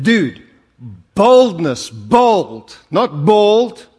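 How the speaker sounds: loud, slow bold not bald, vowel stretched; exaggerated correction. Shown as a demonstration. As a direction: spell out bold against bald loudly. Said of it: "My South African accent, he couldn't get the word".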